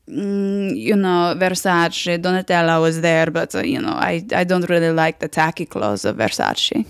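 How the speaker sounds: put-on disdainful name-dropper with an Italian accent; Italian-accented snob, unimpressed. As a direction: drop names in a bored Italian accent. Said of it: "I saw my Italian cousin".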